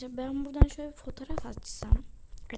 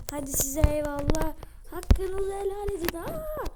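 higher and louder farewell ending in a high yelp; dramatic farewell; 4 semitones higher, 5 dB louder than usual. Pretend. Vlogger jumps off, calling a melodramatic farewell, then shrieks. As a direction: bid goodbye theatrically, then shriek.

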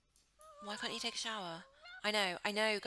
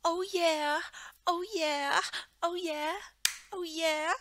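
loud, high repeated cheer; excited cheering; 10 semitones higher, 11 dB louder, much slower than usual. Pretend. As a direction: celebrate like you won. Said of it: "I'm like".

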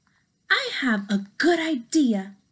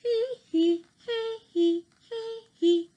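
high hee hee giggle; 11 semitones higher than usual. Acted. Gerald giggling.

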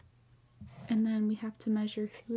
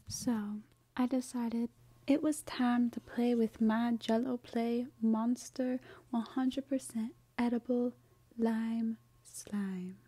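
ordinary talk

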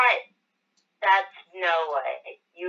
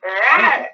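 huge fiery dragon roar; 7 semitones higher, 9 dB louder than usual. Pretend. Playing shark girl, roaring.

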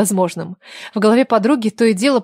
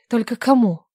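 ordinary delivery

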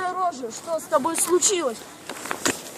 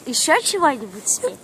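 normal talking voice

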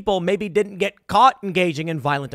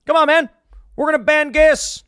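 higher, louder mock Biden voice; a hammed-up Biden saying come on man; 10 semitones higher, 6 dB louder than usual. Host mocking Biden announcing a gas ban, hammed-up. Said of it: "Joe Biden comes in. He's like".

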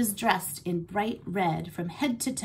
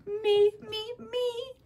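squeaky excited voice; 14 semitones higher, much slower than usual. Acted. Hamster thrilled to be introduced.

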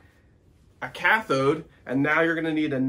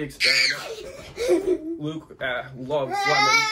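playful excited; 13 semitones higher, slower than usual. Acted. Teasing dad, playful high voice.

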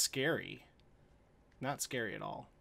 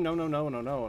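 anguished denial; 3 semitones higher, 8 dB louder than usual. Real, not acted. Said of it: "I haven't screamed".

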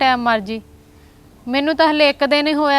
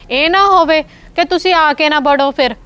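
loud pleading warning; 3 semitones higher, 5 dB louder than usual. Acted. Sister warns brother and wife.

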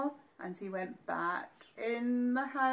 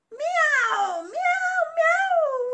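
very high loud meows; cat meows; 11 dB louder, much slower than usual. Acted. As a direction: meow like cats on a wall. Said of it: "went the cats".

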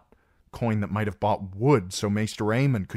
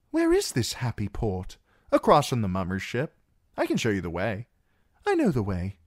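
put-on higher light voice for the girl; young woman voice; 5 semitones higher than usual. A narrator's reading. Narrator voices young woman and Sam.

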